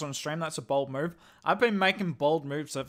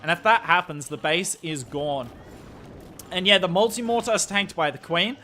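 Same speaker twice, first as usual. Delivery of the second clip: louder, higher caster voice; live esports play-by-play; 5 semitones higher, 6 dB louder than usual. Real, not acted. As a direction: cast the attack live with mounting energy at big moments. Casts the attack live for stream.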